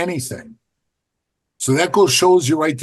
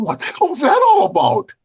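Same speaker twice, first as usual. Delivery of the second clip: baffled inner thought; 14 semitones higher than usual. Retold or imitated. Puzzled inner thought after encounter.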